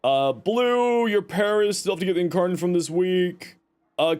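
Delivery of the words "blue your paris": ordinary talk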